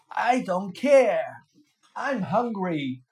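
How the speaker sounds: loud, slow genie shout; stubborn, loud genie refusal. A narrator's reading. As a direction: shout it down at the fisherman. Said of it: "shouted the genie".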